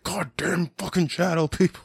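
retelling a furious rant at the shadow people; pissed off. Retold or imitated. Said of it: "So pissed off".

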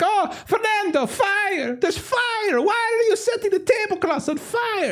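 very high, frantic falsetto shriek; shrill comic panic about fire; panicked partygoer. Acted. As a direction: squeal it out like a panicking guest.

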